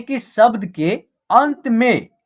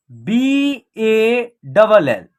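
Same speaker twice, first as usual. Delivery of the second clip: "एस ए डी" letter by letter, slow; spelled out slowly; much slower than usual. Shown as a demonstration. Teacher spells the example letter by letter, slowly. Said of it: "इसका उच्चारण होगा".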